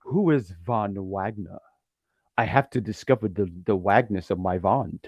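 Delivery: low, soft, dreamy ad voice; shampoo commercial. Acted. Von Wagner finding himself in the meadows, like a shampoo commercial. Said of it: "It sounds like a shampoo commercial".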